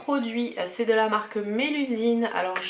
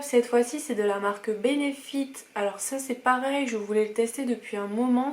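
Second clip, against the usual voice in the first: ordinary delivery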